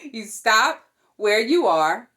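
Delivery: ordinary delivery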